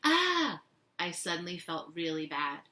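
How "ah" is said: loud, high-pitched "ah"; shrieked cry, much higher and louder than narration; imitated scream. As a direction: mimic a little kid's scream. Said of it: "how he had run away from August".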